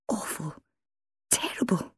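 hushed, choked words, pitch climbing; strangulated mutter. A narrator's reading. Wife just woken from a nightmare, strangulated mutter. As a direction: squeeze it out quietly as if strangled. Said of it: "she muttered in a strangulated voice".

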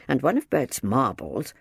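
plain reading voice

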